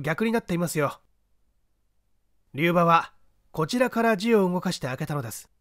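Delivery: a lightly voiced, unhurried gentleman sleuth; composed gentleman detective. The detective calmly directing the man up the ladder.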